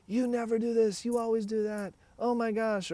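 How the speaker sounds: high, loud, exasperated accusation; accusing outburst. Acted. Triggered partner lashing out at their partner, loud and exasperated. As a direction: blast your partner with blame. Bigger expressions of trauma surfacing.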